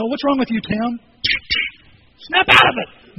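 building to a slow, shouted order; mock scolding. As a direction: act out an exasperated scolder. Acting out a scolding he didn't get.

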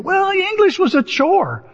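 high, drawn-out mock whine; hammed-up schoolboy grievance; put-on complaint. Boy protests about English class.

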